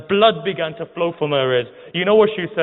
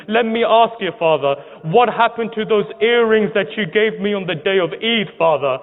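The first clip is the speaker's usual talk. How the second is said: higher, pleading preacher voice carrying the lament; impassioned preaching lament; 4 semitones higher than usual. Acted. Preacher to the mourners, retelling Sakina's grief: impassioned higher lament.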